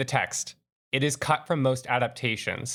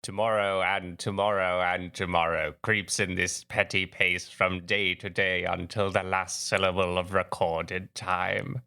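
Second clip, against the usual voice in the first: Patrick Stewart Shakespeare. Pretend. Host reciting Macbeth to the co-hosts, mild British stage accent. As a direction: recite the soliloquy like a grand British stage actor. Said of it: "me doing my, uh, Patrick Stewart".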